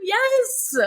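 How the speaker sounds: high, drawn-out yes; excited yes. Real, not acted. Host reacting to what she called him, excited cry. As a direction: let out a thrilled, high yes.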